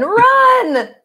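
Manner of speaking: high, loud cry to flee; panicked shout. Pretend. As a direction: yell at everyone to run away. Campers fleeing a snake.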